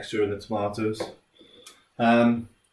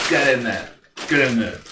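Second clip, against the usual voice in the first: loud, punchy effort; forceful; 3 semitones higher, 6 dB louder than usual. Real, not acted. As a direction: smash it with gusto. Smashing the bag of chips.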